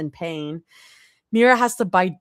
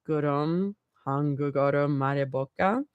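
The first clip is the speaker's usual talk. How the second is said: soft, low purring question; 3 semitones lower, 5 dB quieter than usual. A narrator's reading. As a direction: purr it seductively. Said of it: "nearly in a purr".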